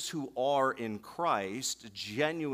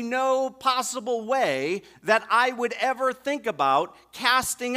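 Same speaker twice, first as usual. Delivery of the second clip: loud, higher emphatic preaching; preaching at full force; 8 semitones higher, 9 dB louder than usual. Acted. Pastor stressing Jesus will never cast out, forceful preaching. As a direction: drive every word home.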